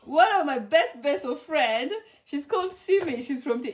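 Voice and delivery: usual speaking voice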